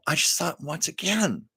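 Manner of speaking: normal talking voice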